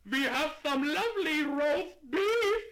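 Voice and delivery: high, loud, slow shopkeeper; slow and loud to a deaf customer. Acted.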